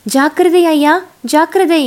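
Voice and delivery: urgent yell of caution at the charging boars; warning shout. Acted. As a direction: shout a danger warning across the clearing. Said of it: "என்று கூச்சலிட்டான்".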